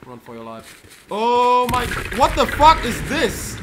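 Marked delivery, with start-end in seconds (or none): 1.1-3.6 shocked shout. Real, not acted. Surprise attack.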